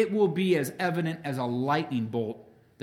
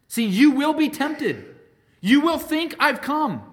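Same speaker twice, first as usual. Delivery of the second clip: louder and much higher, pressing the warning; urgent preaching warning; 9 semitones higher, 7 dB louder than usual. Real, not acted. Preacher warns his hearers of deceivers, loud urgent call. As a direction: sound the alarm to the flock.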